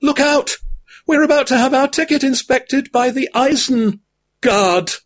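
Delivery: high mock-fearful cry; hammed-up terror in a high hobbit voice; fearful warning. A narrator's reading. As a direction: Frodo warns the company of the inspector. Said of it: "with a look of great fear".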